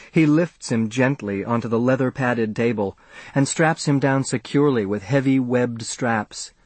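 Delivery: plain reading voice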